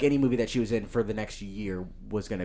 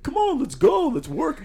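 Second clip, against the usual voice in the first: eager coworker voice; 3 dB louder, slower than usual. Retold or imitated. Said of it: "He was like".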